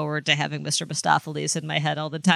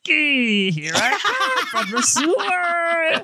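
lizard voice; 11 semitones higher, slower than usual. Retold or imitated. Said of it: "deep-throated lizard voice".

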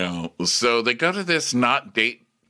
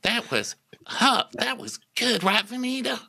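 high, gushing mock praise; gushing fan; 11 semitones higher than usual. Coworkers fawning over her joke, gushing mock voice. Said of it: "They're like".

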